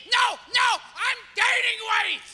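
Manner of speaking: high, strained denial; insisting he's fine. Acted. Sick man to friend, high forced cheer.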